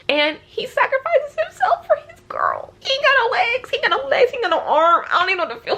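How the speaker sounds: very high, wailing reaction; distraught fan. Real, not acted. Reader reeling from a hero's sacrifice, distraught. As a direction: lament in a high, overwhelmed squeal.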